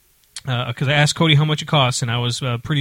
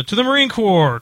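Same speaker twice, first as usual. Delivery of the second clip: retold yell; 5 semitones higher than usual. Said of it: "someone yells".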